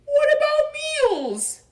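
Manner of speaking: very high, loud, slow plaintive cry; dismayed Pooh. Acted. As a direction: wail for your lost meals. Pooh dismayed about food.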